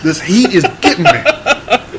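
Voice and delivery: slow drawling heat-struck voice; sweaty Southern gentleman. Pretend. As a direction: play a fat sweaty Southern gent. Sweaty Southern gent impression.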